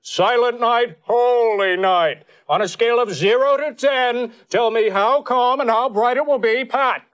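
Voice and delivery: rapid-fire pundit host grilling Pat; John McLaughlin. Retold or imitated. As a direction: McLaughlin impression, high and punchy. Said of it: "John McLaughlin".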